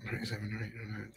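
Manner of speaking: ordinary delivery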